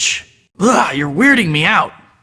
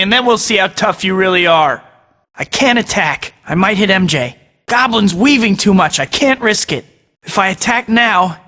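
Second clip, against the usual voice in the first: ordinary talk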